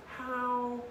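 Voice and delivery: high, strained incredulous plea; stunned disbelief. Retold or imitated. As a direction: question him in mock bewilderment. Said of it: "I'm just dead".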